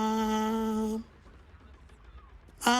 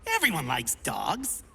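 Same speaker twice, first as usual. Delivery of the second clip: ordinary talk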